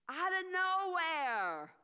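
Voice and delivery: slow booming out of nowhere; dramatic reveal. A narrator's reading. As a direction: make the sudden appearance land. Angel appears to Mary.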